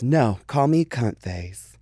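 slow, put-on little girl voice; a toddler's innocent drawn-out request; slow baby voice. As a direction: say it like a sweet toddler, slowly. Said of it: "the baby girl goes".

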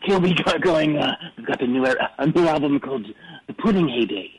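low-pitched Australian accent impression. Guest imitating Kilby announcing a new album, in a low Australian accent. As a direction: put on your best Australian, pitched well down. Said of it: "That's my best Australian accent".

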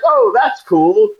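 high, slow, breezy mock enthusiasm; mock naive. Actor's naive reply about the raft, slow mock cheer. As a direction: play it innocently unbothered. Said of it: "I was like".

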